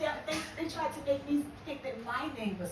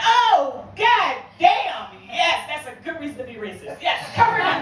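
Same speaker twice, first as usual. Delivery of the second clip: loud, high shocked cry; horrified shouting; shocked yell; 3 semitones higher, 14 dB louder than usual. Retold or imitated. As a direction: recoil in horror, yelling. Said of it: "I was like".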